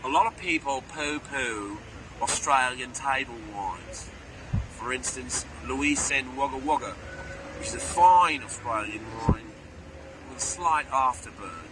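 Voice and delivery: English person attempting an Australian accent; slow, drawn-out put-on Australian accent; mock Australian accent. Shown as a demonstration. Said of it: "an English person doing an Australian accent".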